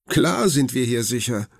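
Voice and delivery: put-on high, eager little boy; boy's voice. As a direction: lift the voice like a curious child. Narrator voices Leon. (hohe Jungenstimme)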